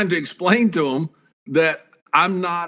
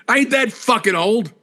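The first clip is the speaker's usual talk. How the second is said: higher-pitched indignant comeback; mock indignant; 6 semitones higher than usual. Wrestler answering the man who says he looks older, mock indignant. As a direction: protest it, half amused.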